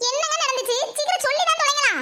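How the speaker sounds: can't wait a second longer; high, exasperated urging; impatient alarm. Acted. Impatient, demands the story now.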